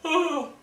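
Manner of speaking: big open yawn; demo yawn. Shown as a demonstration. Singing teacher yawning for her students. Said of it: "have a go at yawning now".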